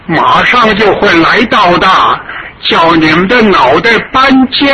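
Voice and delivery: put-on an old patriot's ringing, furious defiance; fiery defiant grandfather. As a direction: hurl the threat back at the invaders. Grandfather defies the invaders.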